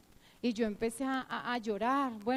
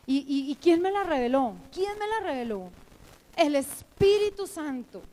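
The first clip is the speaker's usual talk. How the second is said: louder, rising rhetorical challenge; emphatic preaching; 3 semitones higher, 6 dB louder than usual. Real, not acted. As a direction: ask it insistently, then declare the answer. Rhetorical question and answer to class.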